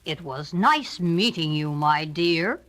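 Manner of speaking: low, slow sly wolf. A narrator's reading. Wolf bidding the girl goodbye, low and slow. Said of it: "said the wolf".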